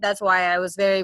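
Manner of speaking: normal talking voice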